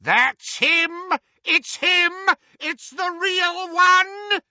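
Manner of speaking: high, loud cry of recognition; pursuer's excited yell; shout. A narrator's reading. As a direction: shout it over a roaring engine, ending long. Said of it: "The hooded Death Eater's shout".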